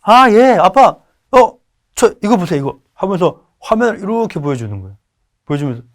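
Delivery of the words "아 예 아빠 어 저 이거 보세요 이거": eager son. Retold or imitated. Son showing his lunch.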